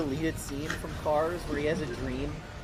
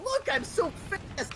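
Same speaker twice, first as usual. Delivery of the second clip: much higher, slow gloating voice; mock gleeful race car; 14 semitones higher, slower than usual. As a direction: ham up the body thief bragging.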